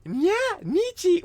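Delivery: squeaky, very high cutesy voice; mock anime girl. Mocking a streamer as an anime girl, squeaky cutesy voice. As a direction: ham up a kawaii anime voice. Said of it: "how do you do it".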